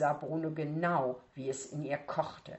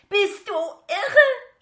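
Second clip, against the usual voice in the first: slow, loud, much higher furious scream; wütender Schrei; 13 dB louder, much slower than usual. Acted. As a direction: shriek at the little brother. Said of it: "schrie sie".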